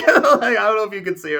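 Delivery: high, tearful laughing voice; real teary laugh. Real, not acted. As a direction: laugh through welling tears. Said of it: "Okay, I'm crying".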